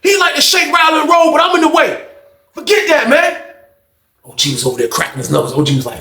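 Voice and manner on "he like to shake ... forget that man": heated protest. Retold or imitated.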